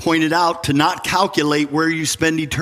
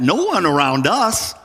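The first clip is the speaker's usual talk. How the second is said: normal talking voice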